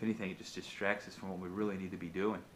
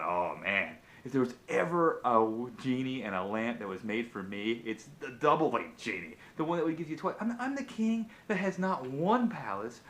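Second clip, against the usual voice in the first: boastful king; 3 semitones higher, 5 dB louder than usual. Acted. Greedy king gloating to himself about his two palaces. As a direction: crow it like a king sure the lamp is his.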